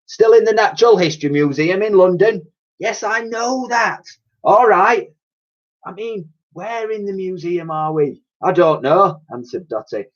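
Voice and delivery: put-on high, loud cockney old dear; old cleaning lady voice. A narrator's reading. Old cleaner warning the girl, fussy cockney voice.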